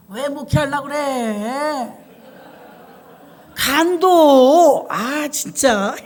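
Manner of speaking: slow, drawn-out professor's grumble; lightly voiced jaded professor; mimicking a professor. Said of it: "이렇게 하시는 분이 있었어요".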